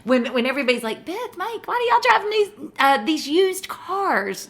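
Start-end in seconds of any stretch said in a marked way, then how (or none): 1.0-4.5 high-pitched mock questioning; mimicking nosy friends. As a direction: put on a high voice for puzzled acquaintances. Voices everybody's nosy question.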